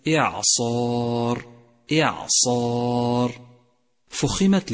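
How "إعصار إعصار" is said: slow recited إعصار with long dark ا after ص; heavy alif. Shown as a demonstration. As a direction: stretch a full, heavy ا in إعصار. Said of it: "فخمت الألف". (ترتيل إعصار مع تفخيم الألف)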